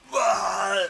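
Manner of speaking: hammed-up puking noise; mock puking retch. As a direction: imitate a sudden vomit. Retold friend puking loudly.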